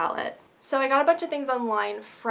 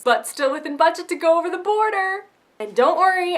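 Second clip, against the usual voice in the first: excited reassurance; 8 semitones higher, 6 dB louder than usual. Real, not acted. Reassuring viewers about spending.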